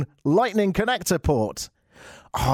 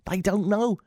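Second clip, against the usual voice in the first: higher-pitched, insistent repeat; 5 semitones higher than usual. Acted. Host insisting about listeners in America, high repeat. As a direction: lift the pitch on the whole phrase.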